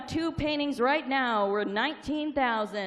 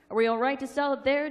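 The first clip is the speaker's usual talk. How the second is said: normal talking voice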